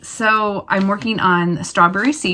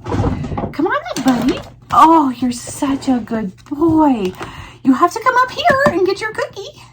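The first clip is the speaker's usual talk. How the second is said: talking to a dog. Real, not acted. Giving the dog cookies.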